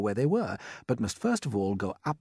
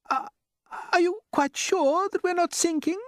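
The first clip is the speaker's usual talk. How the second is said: very high, tearful question; frightened ladybird; 4 dB louder than usual. A narrator's reading. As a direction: ask it close to tears. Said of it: "the ladybird asked".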